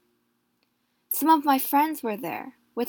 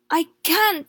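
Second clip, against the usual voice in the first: pleading; 7 semitones higher, 6 dB louder than usual. A narrator's reading. Ill pregnant wife pleading.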